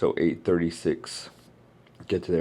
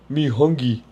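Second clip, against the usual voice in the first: caveman voice; 8 semitones higher, 6 dB louder than usual. Pretend. Caveman-style hungry grunt.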